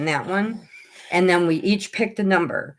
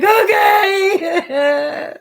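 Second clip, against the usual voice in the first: high, loud strained okay; overwrought okay; 12 semitones higher, 5 dB louder than usual. Retold or imitated. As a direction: blurt it out on the edge of tears. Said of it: "I'm like".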